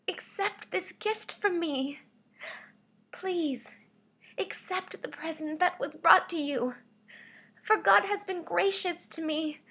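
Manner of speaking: high, urgent begging; pleading, weakly. Shown as a demonstration. Voice actor begging someone to accept a gift, pleading weakly. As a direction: plead in a high voice, swelling at the end. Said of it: "This is my pleading slash weekly read".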